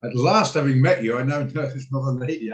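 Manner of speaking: ordinary delivery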